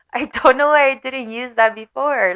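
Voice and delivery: said through real laughter; laughing. Real, not acted. Scolding herself for not leaving sooner, laughing. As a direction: laugh at your own dumbness as you say it.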